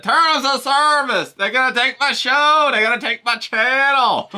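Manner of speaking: high, loud mock panic with a laugh breaking through; imitating Lil K B panicking. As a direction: squeal it like your channel is about to vanish. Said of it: "hear him say that".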